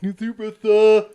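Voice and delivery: high, loud eager attendant voice; squeaky overeager lackey greeting; mock eager salesman.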